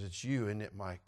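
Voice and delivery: low, soft accusation; knowing murmur. Retold or imitated. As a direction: lean in and say it quietly. Seeker leans over to him.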